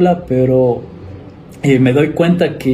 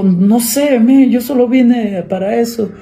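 higher, put-on innocent voice; mock innocent reply; 9 semitones higher than usual. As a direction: play dumb, pitch it up and act clueless. Answering angry police, mock innocent. (a los policías, voz aguda de inocente fingido)

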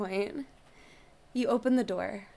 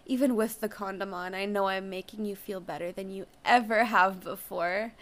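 plain reading voice

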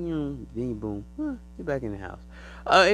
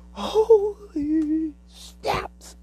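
high, very slow, loud wonder; overwhelmed with awe; amazed cry; 8 dB louder, much slower than usual. Retold or imitated. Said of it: "I'm like".

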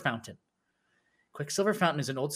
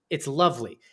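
usual speaking voice

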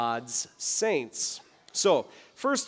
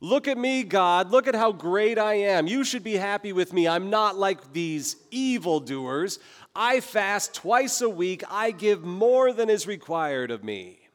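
mock self-satisfied boast, pitched up; boastful Pharisee; 4 semitones higher than usual. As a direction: puff up like a proud Pharisee showing off. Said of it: "This is the kind of heart".

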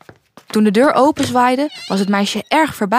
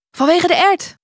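higher-pitched, keen answer; eager guess; 8 semitones higher, 3 dB louder than usual. Acted. Lou guesses why the girl cannot sleep, eager and high. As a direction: blurt out the answer with eager lift.

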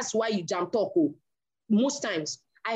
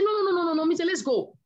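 protesting no; 7 semitones higher, faster than usual. Retold or imitated. A wife refusing to discuss it with her husband, urgent. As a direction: shut the conversation down fast.

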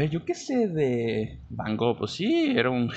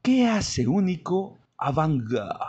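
usual speaking voice

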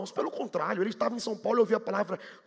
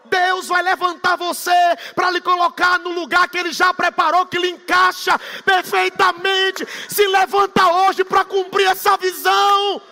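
loud, high, slow rising exhortation; preaching at full force; 7 semitones higher, 9 dB louder than usual. Acted. Pastor urging the congregation to rise, full-force preaching. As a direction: call the church to rise up, voice lifted and slowed.

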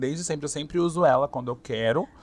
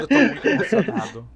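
laughing fan quote; 9 semitones higher, 8 dB louder than usual. Retold or imitated. Fan voiced in a retold story.

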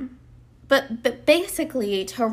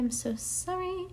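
quiet aside; 8 semitones higher, 10 dB quieter, slower than usual. Real, not acted. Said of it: "it's distracting me".

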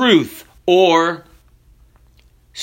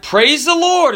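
high-pitched storyteller's shout; acted shout; 9 semitones higher than usual. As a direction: call it out high, without full force. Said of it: "And then he shouted".

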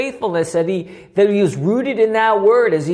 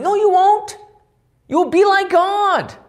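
high-pitched devil rebutting God; devil's contradiction; 11 semitones higher than usual. Acted. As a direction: contradict in a much higher voice. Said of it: "the devil says".

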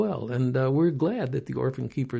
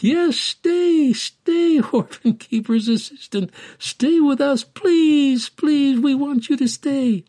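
high, loud, jubilant begging; children clamouring eagerly, voice leaping up; excited children pleading; 9 semitones higher, 6 dB louder, slower than usual. A narrator's reading. Excited children beg the orphan keeper's assistant to stay, pleading joyfully.

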